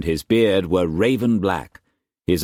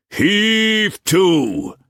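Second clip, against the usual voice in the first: high, loud hail across the water; shouting; 14 semitones higher, 4 dB louder than usual. A narrator's reading. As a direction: bellow the order across to the junk. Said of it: "he shouted".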